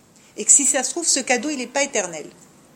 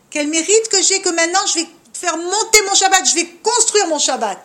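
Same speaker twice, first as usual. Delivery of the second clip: louder, higher, building with pride; joyful enthusiasm; 4 semitones higher, 6 dB louder than usual. Acted. Teacher voicing a woman glad to build her Shabbat, enthusiastic. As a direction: play someone thrilled at the privilege.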